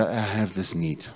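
ordinary talk, nothing marked

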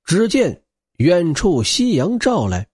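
plain reading voice

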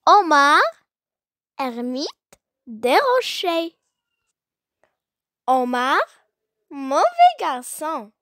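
measured classroom poem reading, punching each name; poem recitation. Acted. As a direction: recite it line by line with strong melodic swings and long pauses.

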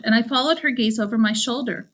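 usual speaking voice

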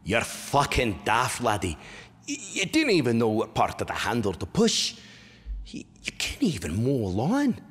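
incredulous Scottish scolding; broad Scots disbelief at an idiot child; exasperated Scottish stepfather. Retold or imitated. Imitating stepfather watching botched mowing, exasperated Scottish scolding. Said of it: "my stepfather looking at me and goes".

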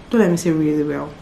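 softly spoken, drained; really tired. Real, not acted. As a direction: talk quietly with little energy left. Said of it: "I'm really tired".

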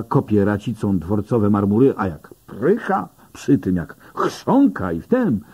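ordinary delivery

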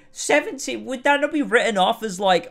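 high, loud incredulous questions; exasperated disbelief. Real, not acted. Exasperated at the plot.

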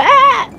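high sharp yelp of pain; sudden yelp. Real, not acted. Yelping as the guard strikes him. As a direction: cry out as if struck. Yelps when hit.

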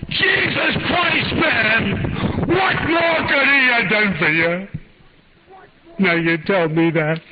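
high, drawn-out scream of outrage; anguished scream. Retold or imitated. Enraged mother screaming at her late son's friend, anguished scream. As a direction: scream it slowly, pitch way up, blazing with anger. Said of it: "looked at me and she screamed".

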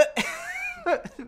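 high-pitched stifled laughing fit; burst of laughter. Real, not acted. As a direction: break into squeaky high laughter. Laughs at Pikachu idea.

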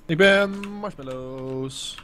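very slow, stretched silly voice; drawn-out nonsense line for laughs; goofy drawl. Pretend. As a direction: drag the words out like nonsense.

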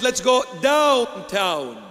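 DOWNTOWN stretched long, pitch sliding down; drawn-out DOWNTOWN. Retold or imitated. Preacher quotes the voice calling him downtown, DOWNTOWN drawn out. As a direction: draw out "downtown" in a long falling slide. Acts out tempting voice, uptown versus downtown.

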